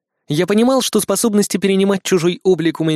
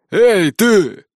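shouted order; 5 semitones higher, 3 dB louder, much slower than usual. Acted.

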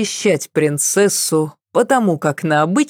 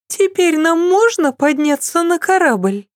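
high, teasing question; mock-polite request; ironic princess; 12 semitones higher than usual. A narrator's reading. Cecile continues ironically. (с иронией в голосе)

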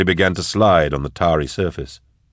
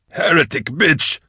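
yelled; 8 semitones higher than usual. A narrator's reading. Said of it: "Vorrier yelled from his cage in hopeless pain".

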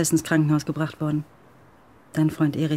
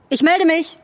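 higher, louder parting call; 13 semitones higher, 8 dB louder, slower than usual. Acted. Charlie calling after the visitor as he leaves. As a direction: send it down the stairwell.

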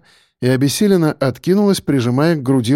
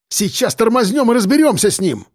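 raised-pitch aggressive bark; angry bark; 8 semitones higher than usual. A narrator's reading. Driver ready to stop and fight, angry bark. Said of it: "рявкнул Белобрысый".

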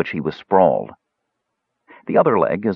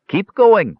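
put-on higher, louder old man order; commanding; 12 semitones higher, 5 dB louder than usual. A narrator's reading. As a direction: bark the command. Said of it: "ordered the Ancient One".

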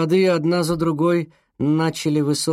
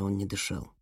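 usual speaking voice

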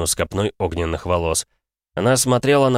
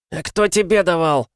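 high, sudden cocky shout; cheeky exclamation; 11 semitones higher, 3 dB louder than usual. Acted. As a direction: blurt it out cheekily. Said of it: "внезапно воскликнул тот".

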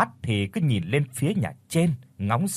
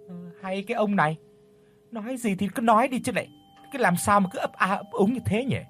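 higher, faster exasperated nagging; impatient wife; 8 semitones higher, faster than usual. A narrator's reading. Wife pressing her stammering husband, higher and exasperated. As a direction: push him to spit it out, impatient. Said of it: "Bà Xuân lại lên tiếng hỏi".

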